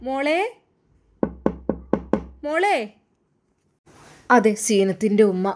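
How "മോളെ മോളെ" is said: mother calling her daughter, rising higher on the second call; mother calling her girl from outside the room; calling through the door. Acted. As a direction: call out gently to a daughter behind a closed door.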